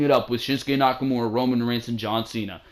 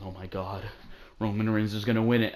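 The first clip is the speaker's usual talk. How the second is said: dread; 3 semitones lower, 7 dB quieter, faster than usual. Retold or imitated. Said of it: "They had you saying".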